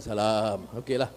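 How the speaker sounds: low, soft retold acknowledgement; subdued reply. Politician returns the youths' salam, low and subdued. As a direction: give a small, reluctant greeting.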